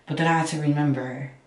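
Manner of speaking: normal talking voice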